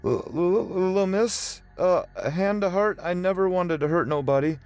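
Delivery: a scared man tripping over words; frightened stammer. Acted. Joe stammers at Angelica.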